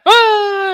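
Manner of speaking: high, loud mock scream; falling scream. Guard flung into the abyss, screaming.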